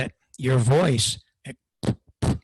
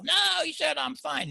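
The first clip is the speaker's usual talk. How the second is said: defensive ham operator; 11 semitones higher than usual. Retold or imitated. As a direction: play the stubborn operator. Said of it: "I hear these guys".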